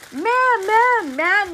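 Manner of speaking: high mock call of ma'am; kid calling out. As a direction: repeat the kid's high call.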